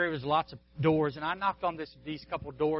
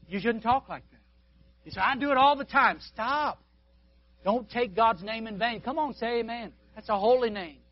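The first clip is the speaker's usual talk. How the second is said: raised, higher scolding from the pulpit; stern preaching; 6 semitones higher, 4 dB louder than usual. Acted. Preacher scolding congregation about swearing, raised stern preaching. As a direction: call them out firmly.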